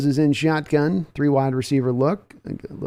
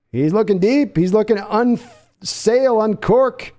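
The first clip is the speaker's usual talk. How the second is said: building excitement; 6 semitones higher, 3 dB louder, slower than usual. Real, not acted. Deep pass called live.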